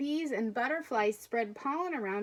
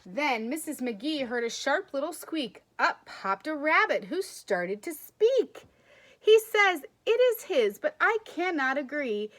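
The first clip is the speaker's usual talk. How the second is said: plain; 4 semitones higher, 5 dB louder than usual. Acted. Reads picture book aloud.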